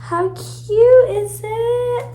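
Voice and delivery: high slow coo. Real, not acted. Cooing over something cute.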